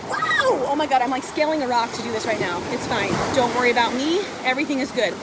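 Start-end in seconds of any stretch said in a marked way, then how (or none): 0.1-0.7 demo wow. Shown as a demonstration. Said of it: "I want you to say".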